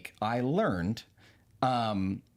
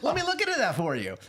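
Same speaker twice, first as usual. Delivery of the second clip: high, bright robotic customer-service check-in; lightly voiced chirpy AI agent; 9 semitones higher than usual. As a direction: ask like a cheerful glitching bot. AI receptionist voiced.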